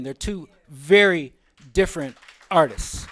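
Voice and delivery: ordinary talk, nothing marked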